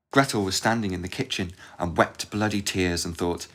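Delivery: ordinary delivery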